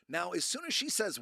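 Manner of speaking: ordinary delivery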